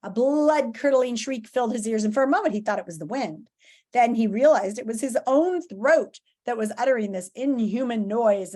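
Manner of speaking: ordinary delivery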